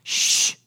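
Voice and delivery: drawn-out hushing sound; long shush. Acted. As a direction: shush the whole room. Hushing after acted divine outburst.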